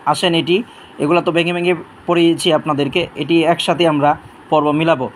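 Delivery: usual speaking voice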